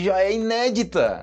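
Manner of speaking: ordinary talk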